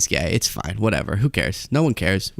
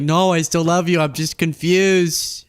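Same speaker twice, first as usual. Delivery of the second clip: higher-pitched melodramatic pleading; mock anguished protest; 7 semitones higher, slower than usual. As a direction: plead tearfully, hammed-up, drawing out the last words. Acting out confused lover's protest.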